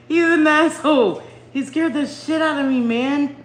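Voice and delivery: high, loud insult; exasperated exclaiming. Real, not acted. Streamer telling viewers about son's scare, exasperated exclaiming.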